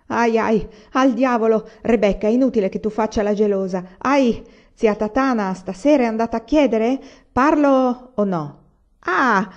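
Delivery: high, loud yelps and threats; pained protest. A narrator's reading. Agata pinched by two friends, threatening to tell, pained. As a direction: cry out as if being pinched.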